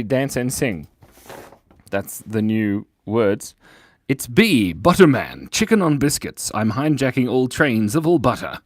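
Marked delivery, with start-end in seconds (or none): none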